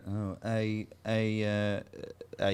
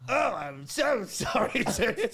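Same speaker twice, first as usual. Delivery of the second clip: slow, laughing apology; awkward laughing; 9 semitones higher, much slower than usual. Retold or imitated. Kid on a prank call to a widow, slow awkward laughing. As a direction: stretch it out, fighting a laugh.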